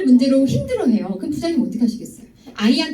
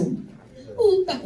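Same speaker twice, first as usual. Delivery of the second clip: cute oppa; 5 semitones higher, 5 dB quieter, much slower than usual. Pretend. Pretty girl saying oppa.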